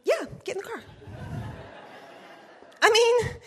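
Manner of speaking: laughing. Retold or imitated. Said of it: "And I was like".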